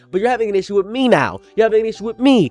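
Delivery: frustrated tantrum; angry whining. Real, not acted. Whiny outrage at studio.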